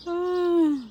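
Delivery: ordinary talk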